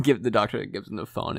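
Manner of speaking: ordinary delivery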